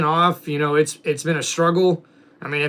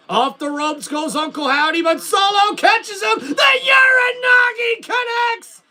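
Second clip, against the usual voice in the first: higher, excited wrestling commentator call; play-by-play commentary; 9 semitones higher, slower than usual. Acted. Streamer calling the wrestling game for chat, excited play-by-play. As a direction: call the match like a TV announcer.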